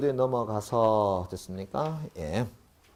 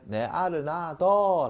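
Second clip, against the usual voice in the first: Gyeongsang dialect; 6 semitones higher, slower than usual. Retold or imitated. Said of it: "경상도 사람이 옆에 있는 여자한테".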